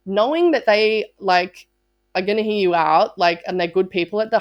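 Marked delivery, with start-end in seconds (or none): none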